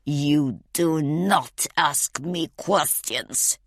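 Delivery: slow, deliberate hissed threat; cold, drawn-out warning in a villain's voice; menacing hiss. A narrator's reading. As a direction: hiss each word at your captive. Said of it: "he hisses".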